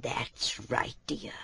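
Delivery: soft hushed whisper. Acted. Hetchel waking Bobbin before dawn, whispering. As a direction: whisper so nobody else wakes.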